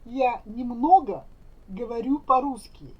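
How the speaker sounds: usual speaking voice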